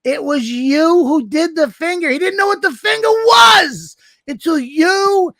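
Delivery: high mock outrage through laughter; laughing accusation. Real, not acted. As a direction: blame him playfully. Mock blaming friend.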